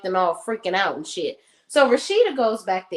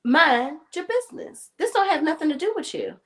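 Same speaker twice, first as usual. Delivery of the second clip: sassy mock retort; 6 semitones higher than usual. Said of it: "But I'm just like".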